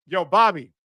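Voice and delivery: usual speaking voice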